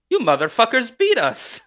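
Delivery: high, loud burst of a line from a deadpan character; sudden outburst. Retold or imitated. Retelling the character's sudden outburst to a friend, high and loud.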